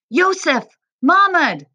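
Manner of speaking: high, urgent calling of names; mother crying out. A narrator's reading. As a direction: call out to loved ones, high and loud. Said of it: "Mohammed's mother cried".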